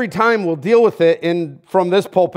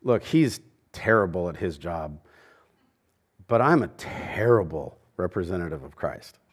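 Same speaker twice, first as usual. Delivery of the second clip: lower, softer inner voice of God; a hint of divine rebuke, lower and quieter; voice of conviction; 9 semitones lower, 6 dB quieter than usual. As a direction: rebuke yourself in a low, hushed voice. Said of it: "God's conviction going".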